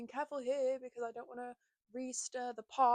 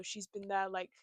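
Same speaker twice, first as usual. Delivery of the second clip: usual speaking voice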